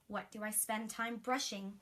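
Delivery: plain reading voice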